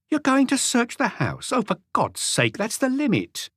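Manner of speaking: an irritated wife protesting the search; indignant housewife. A narrator's reading. Renée's outraged voice.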